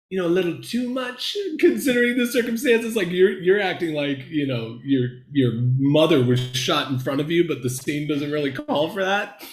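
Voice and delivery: high-pitched, hesitant teacher voice; imitated critical teacher. Voices teacher gently critiquing overacting.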